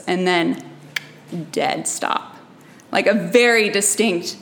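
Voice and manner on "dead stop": hushed. Retold or imitated. Sudden halt in the energy.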